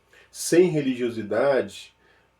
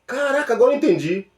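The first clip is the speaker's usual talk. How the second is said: high, fast "aha" rush; excited realization; 9 semitones higher, 4 dB louder than usual. Pretend. Listener finally gets it, high quick excited cry. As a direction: burst out like it finally clicked.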